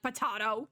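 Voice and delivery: "potato" with AH for the middle vowel; disputed pronunciation. Acted. Detective Frog disputing how Orbit says it, "potato" as puh-TAH-toh. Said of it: "Nobody pronounces it like potato".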